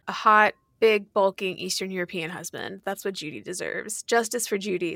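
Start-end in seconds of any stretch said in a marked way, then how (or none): none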